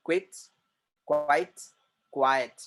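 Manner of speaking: क्विट short, क्वाइट with a long ai; contrasting word series. Shown as a demonstration. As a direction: set the words side by side, each clipped. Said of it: "गौर से सुनिए please".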